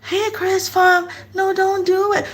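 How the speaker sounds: high, slow sing-song mock warning; uselessly gentle, drawn-out plea; babying warning. Warning someone about to run into a car, mock-gentle coo. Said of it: "if you're about to run into a car".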